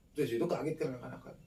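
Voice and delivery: ordinary delivery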